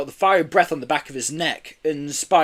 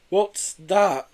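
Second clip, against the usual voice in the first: gasp; 6 semitones higher than usual. A narrator's reading. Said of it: "Joe gasped".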